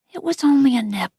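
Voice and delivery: usual speaking voice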